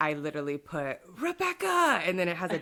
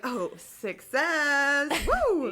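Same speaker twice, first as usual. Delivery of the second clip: cheering; 6 semitones higher, much slower than usual. Retold or imitated. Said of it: "and I was like".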